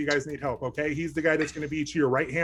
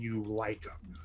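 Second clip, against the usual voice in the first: whispering; 7 semitones lower, 11 dB quieter, slower than usual. Real, not acted. Whispered aside.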